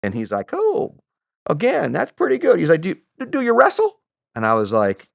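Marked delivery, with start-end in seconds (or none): 0.5-2.6 put-on Southern voice, high and swooping; Southern accent. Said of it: "I don't have a very good Southern accent". 2.8-4.0 high put-on Southern voice; imitated Southern accent, pitched up. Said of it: "I don't have a very good Southern accent".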